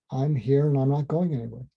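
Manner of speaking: usual speaking voice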